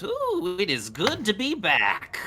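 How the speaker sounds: puny imp Moloch. Acted. Game master voicing freed imp Moloch to the party, squeaky and high. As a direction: squeak it out like a tiny fallen devil pleased to be free. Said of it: "this voice that I wanna do for Moloch".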